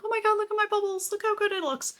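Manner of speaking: high, fast excited gushing. Retold or imitated. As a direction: squeal over the bubbles like a proud parent. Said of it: "Everybody is so sick and tired of me showing them".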